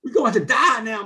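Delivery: heated retold shouting; retold angry yelling. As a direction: yell threats at the new guy. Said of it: "screaming at me".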